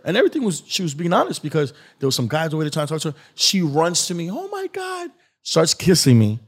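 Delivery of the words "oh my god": very high, soft mock squeal; light, high-pitched mimicked swoon; imitated girlish gushing. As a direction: imitate her thrilled greeting in falsetto. Voicing drunk girl running to him.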